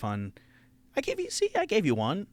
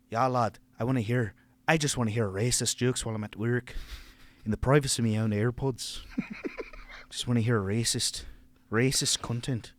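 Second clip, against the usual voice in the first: grumbling working lad; 3 dB quieter than usual. Acted. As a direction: confide it as a wistful lad at work.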